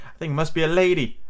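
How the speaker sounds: a touch of fearful realisation; scared voice. A narrator's reading. Slightly realising the bird is a lady, mildly scared. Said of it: "he said in a scared voice".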